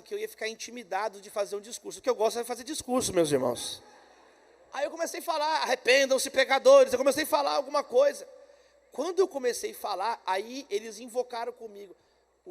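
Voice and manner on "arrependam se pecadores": loud mock street-preacher cry; preaching call. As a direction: call the sinners out loudly. Imitates his preaching to students.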